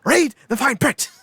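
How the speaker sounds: high, loud haughty dismissal; Willy Wonka impression. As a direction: Wonka refusing the pineapple pizza fan. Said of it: "goes full Willy Wonka".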